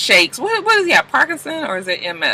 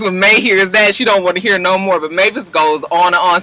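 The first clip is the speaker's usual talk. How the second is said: usual speaking voice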